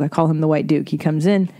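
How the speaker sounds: ordinary talk, nothing marked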